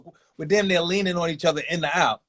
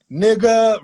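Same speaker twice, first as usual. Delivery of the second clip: loud, long, higher yell from the back of the bus; retold yell; 5 semitones higher, 8 dB louder than usual. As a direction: drag it out loud and long as a taunt. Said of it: "having the balls to yell".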